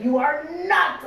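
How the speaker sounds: hammed-up telling off; mock yell. Husband Mike yelling at her over coupons, mock yell. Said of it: "Mike yells at me all the time".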